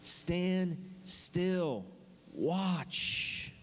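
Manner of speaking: high, spaced-out commands; preacher acting out a firm halt, word by word; commanding Moses. Moses halting the frightened people, spaced command. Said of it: "And Moses says to him".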